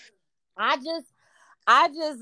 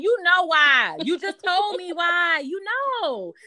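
raised, exasperated pointing out; 8 semitones higher, slower than usual. Retold or imitated. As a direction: tell them flatly they know. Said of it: "I be like".

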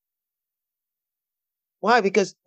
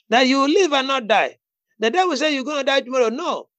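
raised, emphatic preacher's declaration; fervent preaching; 6 semitones higher than usual. Real, not acted. Preacher urging a friend to declare life over Vanessa, fervent preaching. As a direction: preach it with bold authority, pitched up.

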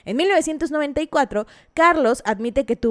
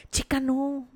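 quiet disgusted no; 7 dB quieter, slower than usual. Real, not acted. Gossip vlogger recoiling at royal sexting, quietly disgusted. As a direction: mutter it under your breath in disgust.

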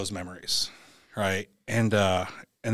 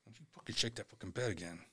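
very soft, quick threat; muttered warning; 16 dB quieter, faster than usual. Retold or imitated. Retells muttered warning under mask.

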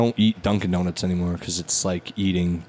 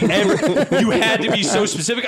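donut lady voice; 11 semitones higher than usual. Retold or imitated. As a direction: snap like a brusque shopkeeper. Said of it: "And she's like".

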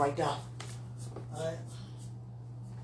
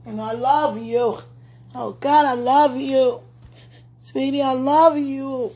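emotional outburst; 5 semitones higher, 5 dB louder, slower than usual. Real, not acted. Praying woman cries out her love to God, rising and loud.

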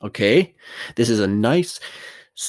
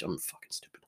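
whisper; 5 semitones lower, 17 dB quieter than usual. Retold or imitated. Retold self-muttering to himself.

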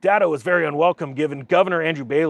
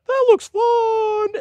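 very high, slow, dopey; sing-song simpleton at the cliff edge; high slow cartoonish sheep voice; mocking sheep voice; 5 dB louder, much slower than usual. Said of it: "the other one's like".

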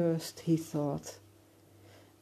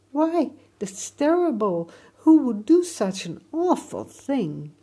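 shocked outrage; 8 semitones higher, 10 dB louder than usual. Acted. Boy exclaims in outrage.

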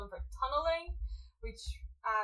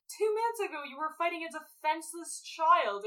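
indignant; 7 semitones higher, 4 dB louder than usual. Retold or imitated. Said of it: "she's like".

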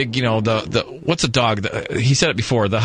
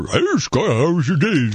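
talking dog voice. Pretend. Voices dog greeting him.

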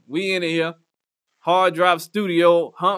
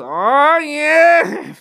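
mock moan; 6 semitones higher, 6 dB louder than usual. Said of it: "all I hear is".

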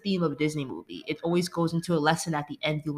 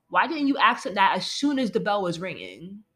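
usual speaking voice

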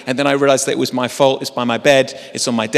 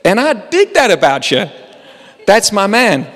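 amused athlete; 7 semitones higher, 3 dB louder than usual. Retold or imitated. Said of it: "he says to Jerry".

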